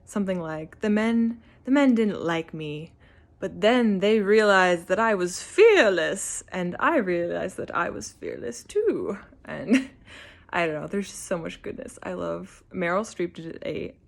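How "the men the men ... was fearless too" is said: put-on Julia Child quote. Quoting Julia Child's letter to her pen pal, loud and proud. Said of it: "She's like".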